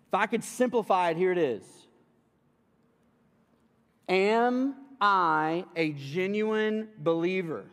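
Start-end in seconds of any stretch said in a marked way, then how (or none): 4.1-7.7 solemn preaching question. Acted. Poses the main question.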